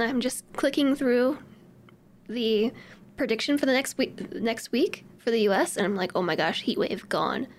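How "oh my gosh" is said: normal talking voice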